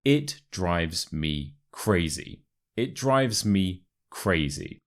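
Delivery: slow, evenly spaced teaching delivery; slow model phrase. Shown as a demonstration. Teacher introduces expression three to learners, slow and clear. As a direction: say it slowly with space before "crazy". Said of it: "Expression number three".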